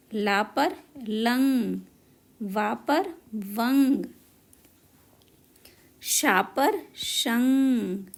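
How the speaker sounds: put-on a slow, stretched drill of each sound for young learners; the teacher models every syllable, letter by letter; slow phonics drill. Shown as a demonstration. Teacher sounding out syllables for kids, slow and stretched.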